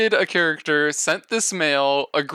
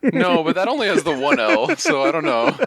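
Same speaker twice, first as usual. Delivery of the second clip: laughing; 10 semitones higher, 4 dB louder than usual. Real, not acted. Friends laughing at the joke.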